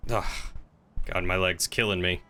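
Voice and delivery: ordinary delivery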